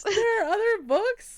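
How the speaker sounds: high, loud, drawn-out echo through laughter; slow, squealed teasing incredulity; mock astonishment. Real, not acted. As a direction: repeat it back in laughing disbelief. Echoes friend in teasing disbelief.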